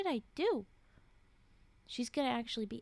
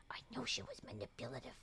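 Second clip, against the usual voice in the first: lower, quick knowing whisper; low fast whisper aside; 11 semitones lower, 9 dB quieter, faster than usual. Acted. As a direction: hiss the thought quickly under your breath. Narrator's private thought.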